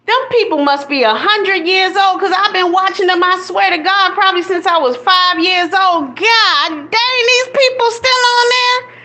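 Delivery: high-pitched, astonished rant; incredulous exclaiming. Real, not acted. Streamer amazed that old soap stars are still on, high incredulous rant.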